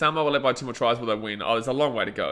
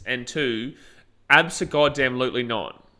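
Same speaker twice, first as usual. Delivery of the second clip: ordinary talk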